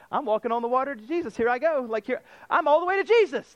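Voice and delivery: fast, rising, excited play-by-play; giddy Peter. Retold or imitated. Preacher voicing Peter striding to Jesus, giddy and fast. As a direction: hype yourself up step by step toward Jesus.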